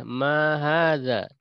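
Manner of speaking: slow, drawn-out teacher model; slow Arabic drill reading; slow model phrase. Shown as a demonstration. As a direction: stretch each word out for the class to copy. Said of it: "bareng-bareng diikuti".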